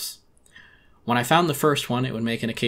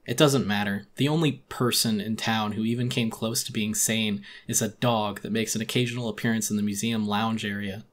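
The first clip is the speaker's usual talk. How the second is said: plain reading voice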